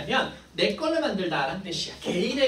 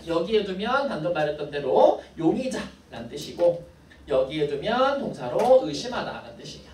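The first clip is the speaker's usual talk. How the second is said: normal talking voice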